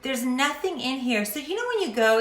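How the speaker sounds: ordinary talk, nothing marked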